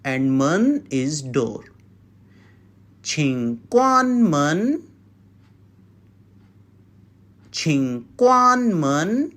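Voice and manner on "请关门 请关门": 请关门 said slowly for the class; slow and clear, for repetition. Shown as a demonstration. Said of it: "Yes, once more".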